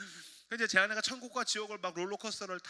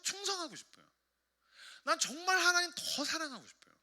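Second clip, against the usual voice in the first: high-pitched, fervent confession; acted heartfelt longing, pitched high; earnest pleading; 10 semitones higher, 7 dB louder than usual. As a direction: plead your devotion to God. Acted earnest plea to God.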